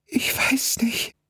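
very high, slow, tearful whisper; crying whisper. A narrator's reading. Girl bursting into tears on the emergency call. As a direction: break down in whispered sobs. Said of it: "Das Mädchen brach in Tränen aus".

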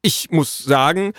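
a touch of pompous grumbling; grumbling indignantly. A narrator's reading. Messenger complaining about Elos's behaviour, indignantly. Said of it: "murrte der Bote".

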